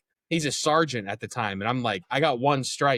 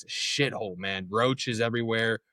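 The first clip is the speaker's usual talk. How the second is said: usual speaking voice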